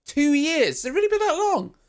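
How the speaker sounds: high, incredulous two years; surprised. Real, not acted. Shocked to hear Tom moved to Essex two years ago, high surprise. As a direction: squeal it in disbelief.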